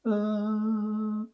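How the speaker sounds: a soft, held sung ah on any pitch. Shown as a demonstration. Teacher singing a random pitch for the student, a held soft ah. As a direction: hold one steady tone on ah. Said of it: "Você vai manter sua voz".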